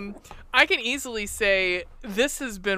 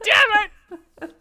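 mock outburst; 11 semitones higher, 5 dB louder than usual. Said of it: "Me going".

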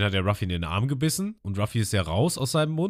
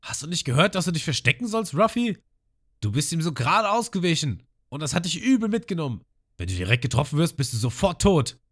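much higher, scolding, panicked voice; frantic Lysop; 9 semitones higher than usual. Retold or imitated. Lysop scolds Ruffy for not hiding, high and frantic. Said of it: "Lysop und er meint".

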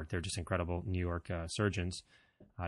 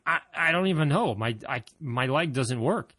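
panicked confusion; 6 semitones higher, 5 dB louder than usual. Retold or imitated. Can't stand after fall.

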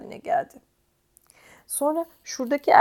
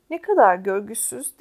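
ordinary delivery

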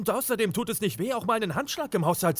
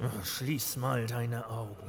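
much lower and softer, slowed right down; lower, softer, patient slow aside; 9 semitones lower, 8 dB quieter, slower than usual. Acted. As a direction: say it gently, like humouring a child. Telling S2 to close eyes.

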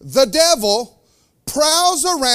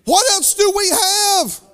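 high, slow, urgent call out; preaching at full force; fervent preaching; 9 semitones higher, 3 dB louder, slower than usual. Real, not acted. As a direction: press the church for an answer. Preacher calling out to congregation.

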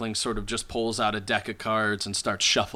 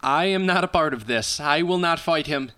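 higher, emphatic character voice; earnest informant refusing; 6 semitones higher, 4 dB louder than usual. Acted. Informant refusing to fight the sorcerer, earnest and emphatic. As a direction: firmly refuse to be involved. Game master plays the informant.

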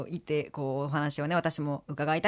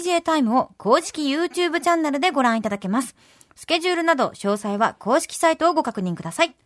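clear, lively announcer delivery; polished radio announcement; radio information read; 5 semitones higher, 3 dB louder than usual. Acted. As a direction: read out programme news with crisp energy. Reads show information.

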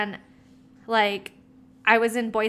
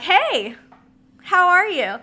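higher, louder sing-song mock hello; fake sweet greeting; 10 semitones higher, 8 dB louder, slower than usual. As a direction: ham up a fake cheerful hi. Mocking her own fake greeting.

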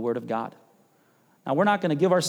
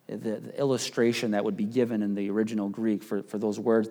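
ordinary talk

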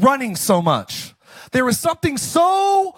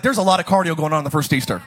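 lower, fast Orange County dude read; Orange County mentality; 9 semitones lower, faster than usual. Pretend. Pastor reads Easter with an Orange County mentality, fast and low. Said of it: "reading this with an Orange County mentality".

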